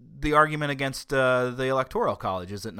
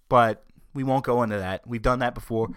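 ordinary talk, nothing marked

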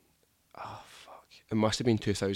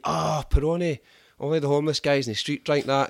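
higher, louder mock disdain; Italian pals; 5 semitones higher, 4 dB louder than usual. Italians mocking Peroni, higher and louder. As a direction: sneer at the beer. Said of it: "they're like".

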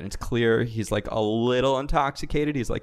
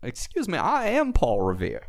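put-on southern accent; 8 semitones higher than usual. GM playing lost-horse Paul Revere, hammy southern accent. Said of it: "What accent is this?".

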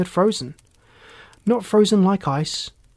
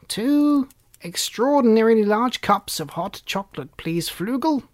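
elder elf ordering; 5 semitones higher, 3 dB louder than usual. A narrator's reading.

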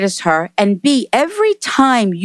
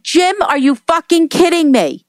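higher, indignant mock scolding; retold angry outburst; 8 semitones higher than usual. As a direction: relay her outrage at him, pitched up. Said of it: "she started yelling at me".

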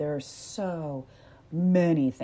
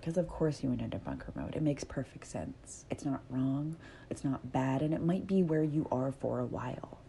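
soft reassurance; 3 semitones lower, 7 dB quieter than usual. Real, not acted. Reassuring listener softly.

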